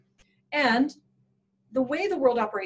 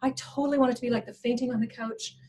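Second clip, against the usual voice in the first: ordinary talk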